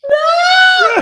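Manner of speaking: very high drawn-out mock shriek; sky-high tantrum wail, played for laughs; imitated screaming. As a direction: mimic a child's screamed refusal.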